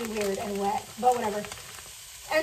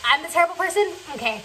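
sarcastic retort; 4 semitones higher, 4 dB louder than usual. Retold or imitated. Retorting to the bullying girl.

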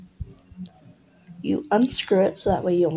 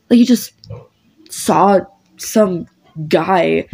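louder, pleading then pushy friend Vance; angry friend Vance; 4 dB louder than usual. Acted. Vance corners his upset best friend, getting angry. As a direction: confront a friend who won't talk to you, insisting.

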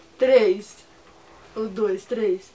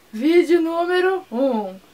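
slow announcement; 3 semitones higher, 3 dB louder, slower than usual. Real, not acted. Announcing video to camera.

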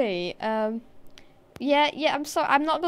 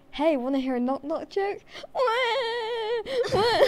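mock crying; 7 semitones higher than usual. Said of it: "It's like a".

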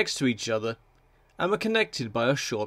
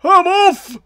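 high, loud bolting cry; loud, high-pitched alarm; panicked wail; 12 dB louder than usual. A narrator's reading. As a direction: cry out as you flee. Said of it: "wailed one".

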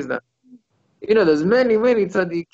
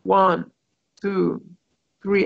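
one word at a time, with pauses between; deliberate, separated count; careful counting; 2 semitones higher, much slower than usual. Shown as a demonstration. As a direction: count it out like money, each number on its own. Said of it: "on its own carefully, like as if you're counting money".

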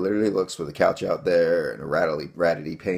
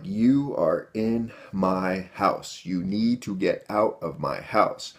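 slow and firm; much slower than usual. Retold or imitated. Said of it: "I'm like".

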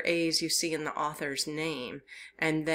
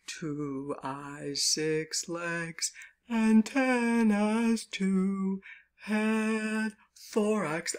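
deep Papa Bear voice; 2 semitones lower, slower than usual. Shown as a demonstration. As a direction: lower sung Papa Bear voice. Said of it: "you're gonna have to put a deep voice in".

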